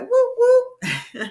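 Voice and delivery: slow, high, loud woo woo; showing the kids a happy dance cheer. Shown as a demonstration. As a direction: whoop like you're dancing. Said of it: "Can you do a little happy dance?".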